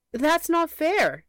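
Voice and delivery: high, slow protest with NOT leaned on; a young raccoon's high indignant exclaim. Acted. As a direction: cry out that it's unfair. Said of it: "exclaimed Rita".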